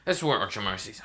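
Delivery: usual speaking voice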